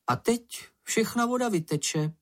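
high little animal voice; small creature voice. A narrator's reading. Čenich worries the water will run out, high creature voice.

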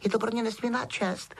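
high-pitched little elf voice, full of reverence; Dobby, awed house-elf. A narrator's reading. Elf greeting his hero Harry, awed. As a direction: squeak it like a humble servant.

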